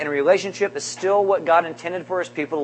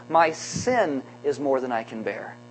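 softer, slower remorseful Cain; 4 semitones lower, 6 dB quieter, slower than usual. Retold or imitated. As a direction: confess it heavy with regret. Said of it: "Cain could actually be saying".

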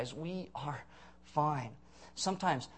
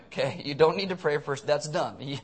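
usual speaking voice

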